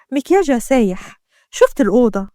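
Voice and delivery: ordinary delivery, nothing marked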